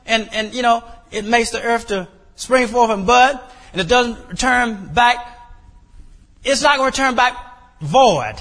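high, pressing prayer to God; fervent prayer. Acted. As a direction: plead with God, holding him to his word.

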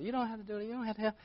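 high, soft voice declining help; imitated neighbor. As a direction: voice a meek neighbor, up high and quiet.

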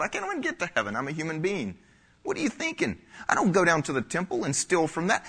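high-pitched, incredulous protest; indignant disbelief. Acted. Congregant protesting to God over being accused of robbing him, indignant disbelief. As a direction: play offended innocence, voice leaping up high.

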